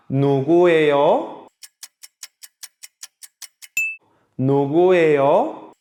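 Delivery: "누구예요" with a clear rise on the final 요; rising question intonation. Shown as a demonstration. Korean teacher models the question for students, "누구예요" rising at the end. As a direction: lift the end of "누구예요" sharply upward.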